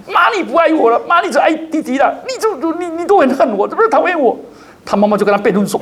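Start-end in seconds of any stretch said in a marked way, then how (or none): 0.1-4.3 put-on high-pitched child scolding her mother; angry four-year-old. Pastor imitating a four-year-old scolding mum in a high voice. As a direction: whine it up high like a furious little kid. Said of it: "才四岁多就大骂她的妈妈说".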